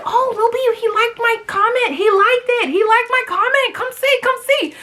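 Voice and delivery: mock teen squealing over a like; excited daughter. Daughter urging mom to look, high gushing voice. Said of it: "So she was like".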